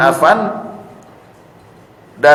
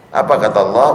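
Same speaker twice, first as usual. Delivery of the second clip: usual speaking voice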